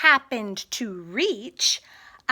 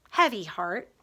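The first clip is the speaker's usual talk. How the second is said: ordinary delivery, nothing marked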